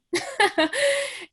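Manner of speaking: high laugh; short high laugh. Real, not acted. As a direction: laugh at the surprise of it. Laughs about badminton origin.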